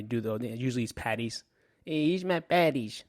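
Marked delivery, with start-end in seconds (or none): 1.8-3.0 bad, high mock Irish accent; a cheesy fake Irish accent, pitched up; terrible Irish accent. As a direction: ham up a bad Irish brogue. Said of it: "That's a terrible Irish accent".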